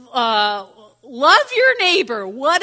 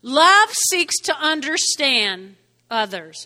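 ordinary talk, nothing marked

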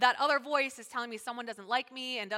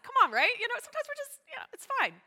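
high-pitched coaxing friend; Pat; 11 semitones higher, 7 dB louder, faster than usual. Retold or imitated. Imitating a friend brushing off drama, high coaxing. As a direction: plead lightly in a raised voice. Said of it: "my friend Pat's like".

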